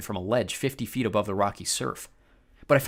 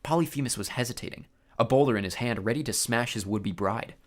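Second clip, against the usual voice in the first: usual speaking voice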